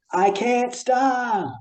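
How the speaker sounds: high, very slow pleading voice; whiny helpless kid. Retold or imitated. Retelling the camp kid caught peeing, high slow whine. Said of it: "And then he goes".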